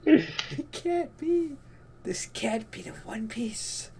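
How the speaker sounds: high, slow, thin near-whisper; can't-believe-it whimper, drawn out and high; stunned disbelief. Real, not acted. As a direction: squeak it out slowly in disbelief.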